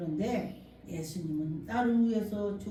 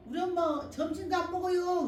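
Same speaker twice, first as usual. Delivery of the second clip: pleading daughter; 8 semitones higher than usual. Retold or imitated. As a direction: beg the pastor for help, pitched up. Daughter reports to pastor.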